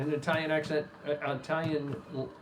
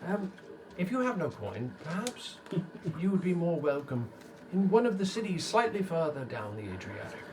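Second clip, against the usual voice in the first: tax collector explaining; 4 semitones higher, 3 dB quieter than usual. Acted. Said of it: "He says".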